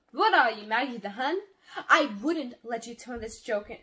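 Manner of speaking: loud, indignant outburst; angry challenge. A narrator's reading. Sister pushes brother to the ground, angrily challenging. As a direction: throw it at him in outrage.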